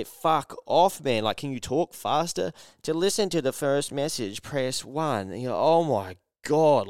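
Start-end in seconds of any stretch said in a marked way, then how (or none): 0.0-2.5 frustrated outburst. Retold or imitated. Yelling at slow voicemail. 2.8-5.3 higher, drawn-out machine announcer; robotic voicemail voice. Retold or imitated. Mocking automated voicemail system. 5.5-6.9 exasperated groan. Retold or imitated. Exasperated at voicemail.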